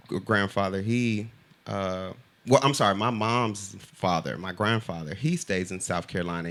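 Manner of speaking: normal talking voice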